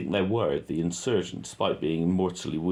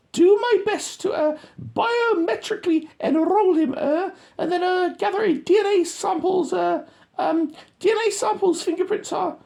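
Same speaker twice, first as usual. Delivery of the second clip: much higher, louder mock radio talk; squeaky radio voice; 7 dB louder than usual. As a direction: mimic a soldier on the radio, squeaky. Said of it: "he's on the radio".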